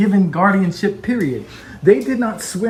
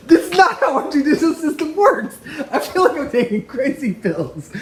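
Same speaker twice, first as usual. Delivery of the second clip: higher, laughing through the words; laughing in disbelief; 9 semitones higher than usual. Real, not acted. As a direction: laugh at how absurd it is. Exasperated at the judge, laughing.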